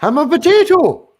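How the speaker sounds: squeaky high potato voice; silly high voice. Pretend. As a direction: pitch way up, goofy. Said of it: "That's the best way to say it".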